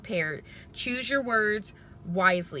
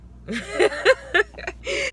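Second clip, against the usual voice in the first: high burst of laughter; 13 semitones higher, 5 dB louder than usual. Real, not acted. Laughs at own remark.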